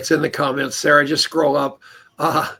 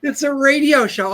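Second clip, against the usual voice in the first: indignant; 10 semitones higher, 7 dB louder, slower than usual. Retold or imitated. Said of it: "I go".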